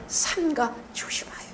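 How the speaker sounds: whispered, no voice; preacher whispering a stern caution; hushed warning. Real, not acted.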